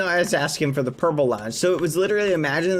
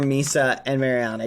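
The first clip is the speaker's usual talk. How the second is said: ordinary talk, nothing marked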